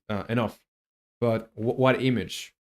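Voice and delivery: normal talking voice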